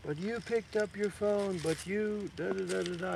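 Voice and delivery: high, far-off mocking voice of a complaining student; mocking objector. Teacher mocking a student who complains about phones, high whiny voice.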